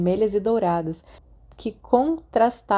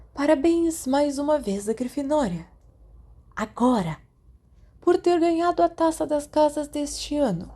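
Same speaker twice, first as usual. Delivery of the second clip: put-on a higher, proud old headmaster addressing the hall; headmaster's speech; 8 semitones higher than usual. A narrator's reading. Dumbledore addressing the students at the feast, proud and higher. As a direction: speak to the whole hall like a beaming headmaster.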